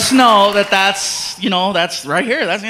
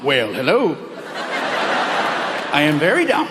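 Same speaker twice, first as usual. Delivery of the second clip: smooth low voice; 6 semitones lower than usual. Retold or imitated. Flirty reply to invitation.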